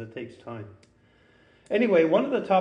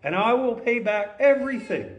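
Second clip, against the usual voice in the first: high, loud pleading promise; desperate promise to repay; begging; 8 semitones higher, 6 dB louder than usual. A narrator's reading. Servant begs his master, high loud pleading. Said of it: "he begged".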